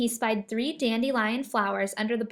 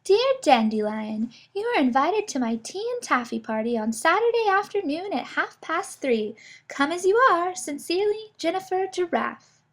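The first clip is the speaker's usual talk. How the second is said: higher, genteel giraffe voice reading an invitation; giraffe reading letter; 6 semitones higher, 4 dB louder than usual. A narrator's reading. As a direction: read it like a gracious hostess.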